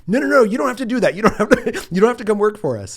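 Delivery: high, loud, rushed no no no; an inexperienced spy panicking to wave her off; flustered protest. Retold or imitated. He's so inexperienced.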